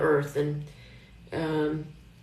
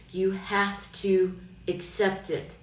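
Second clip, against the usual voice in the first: ordinary talk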